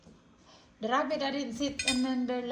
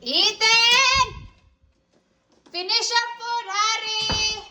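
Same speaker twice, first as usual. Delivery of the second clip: calling out; 6 semitones higher, 10 dB louder, slower than usual. Real, not acted. Calling child to finish food.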